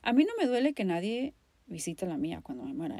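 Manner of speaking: ordinary delivery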